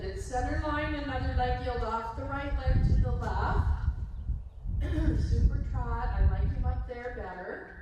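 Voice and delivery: brisk projected riding commands. Real, not acted. Instructor calling across arena.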